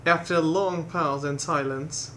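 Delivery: ordinary talk, nothing marked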